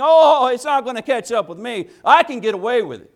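high, loud, cocky inner voice; careless sinner. Retold or imitated. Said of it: "Now you think".

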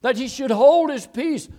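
loud, high preaching shout; forceful rebuke. Acted. Preacher telling how the crowd rebuked him, shouting. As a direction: thunder it out.

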